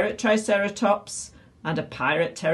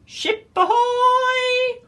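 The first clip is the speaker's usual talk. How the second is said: very high, loud, stretched-out cry; lookout's shout; 6 dB louder, much slower than usual. A narrator's reading. Violet shouts from the crow's nest, high lookout call. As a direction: sing it out long from up high. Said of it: "Pirate Violet shouted from the crow's nest".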